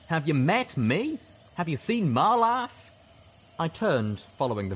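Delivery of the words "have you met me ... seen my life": slow, loud indignation climbing higher; rising voice. A narrator's reading. Calamity argues with his double, voice rising to a shout. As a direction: build to an incredulous high shout. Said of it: "His voice was slowly rising".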